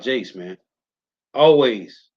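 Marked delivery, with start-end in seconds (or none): 1.3-2.1 'Always' punched out hard and loud; emphatic always. Real, not acted. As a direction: slam the word 'Always' for emphasis. Preacher hammers home his point.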